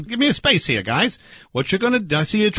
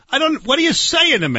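exasperated bafflement; 7 semitones higher than usual. Acted. Baffled argument over soy burgers.

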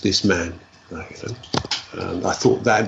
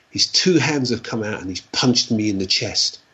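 normal talking voice

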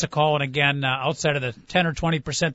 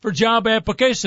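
tired telethon host; 6 semitones higher, 4 dB louder than usual. Acted. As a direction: announce him like a weary telethon emcee. Introduces the co-host like a host.

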